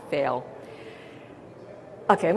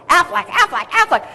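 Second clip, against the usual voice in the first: Aflac, Aflac quacked much higher and louder like a duck; 11 semitones higher, 5 dB louder than usual. Shown as a demonstration. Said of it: "you sound like a duck quacking".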